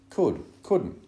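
loud, high back-and-forth contradiction; arguing. A narrator's reading. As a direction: argue both sides in sharp bursts. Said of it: "the platform was full of arguing".

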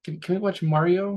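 put-on high, eager child voice begging; little daughter. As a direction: imitate a small girl pleading to watch. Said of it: "my daughter's like".

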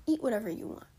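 usual speaking voice

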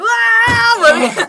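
excited shout. Retold or imitated. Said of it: "셜록스가".

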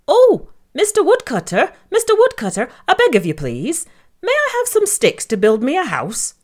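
squeaky little pig, pleading; high piglet voice. Acted. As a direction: beg sweetly in a high piggy voice. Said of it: "said Gary".

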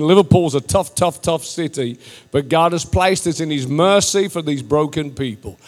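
normal talking voice

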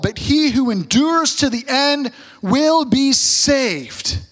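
measured, solemn Bible reading; scripture reading. Acted. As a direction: read the verses with weight. Reads scripture aloud.

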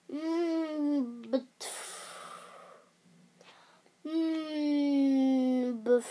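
long muffled hum into a sputter; muffled mouth. A narrator's reading. Princess speaks under Wilbur's paw, muffled. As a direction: try to talk through a covered mouth.

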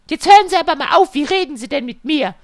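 high, loud, fast huffy customer; indignant customer. Retold or imitated. Customer scolding the technician, high fast mock huff. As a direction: scold him in her high put-on voice.

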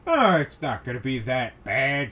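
lightly put-on dismissive guy; mock know-better brush-off; Daryl. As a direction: imitate a scoffing naysayer. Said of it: "Daryl over there decides to go".

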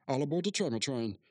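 ordinary delivery

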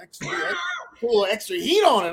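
usual speaking voice

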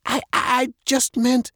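put-on high-pitched hesitant girl; stammering. A narrator's reading. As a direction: stumble on the first word, defensive. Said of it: "Maddie stammered".

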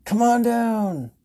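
drawn-out, high and loud like a host; game show call. Retold or imitated. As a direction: call them down like a TV announcer. Flailing arms, calling customers over.

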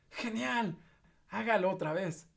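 high, thrilled cheer; excited shout. Acted. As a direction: egg him on, thrilled. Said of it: "Elizabeth gritó". (grito muy emocionado)

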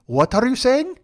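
lightly mimicked Indian accent, puzzled. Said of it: "an Indian guy just goes".

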